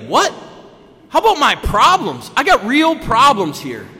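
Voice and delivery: high, loud indignant complaint; mock outraged protest. To his sponsor, loud and high indignant complaint. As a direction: whine it loud and high, feeling wronged.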